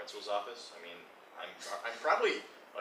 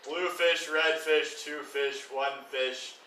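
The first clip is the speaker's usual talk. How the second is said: loud halting word-by-word reading; reading aloud loudly; 3 semitones higher, 8 dB louder, much slower than usual. Pretend. Player reading a spell book backwards, loud and halting. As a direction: sound out each word like a child learning to read.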